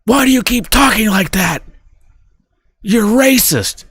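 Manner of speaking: high-pitched mock scolding; accusing wife. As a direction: imitate an offended wife accusing her husband. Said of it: "She goes".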